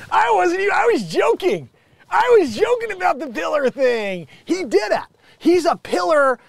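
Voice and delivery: high, loud excited outburst; excited disbelief. Real, not acted. Vlogger reacting to his joke coming true, excited. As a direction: burst out in amazed disbelief.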